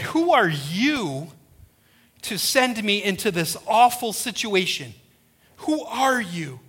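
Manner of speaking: slow, emphatic "Who are you"; challenging question. Acted. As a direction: stretch "are you" and push the question back at God. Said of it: "Moses flips his original question".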